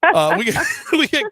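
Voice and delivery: host recovering from a laughing fit; laughing while announcing. Real, not acted. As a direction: laugh breaking into the station ID.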